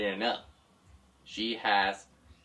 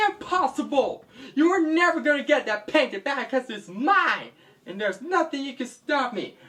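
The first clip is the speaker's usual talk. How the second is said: pitched-up frantic defiance; higher defiant cry; high defiant villain; 10 semitones higher than usual. Acted. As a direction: protest shrilly, cornered. Villain cornered, protests.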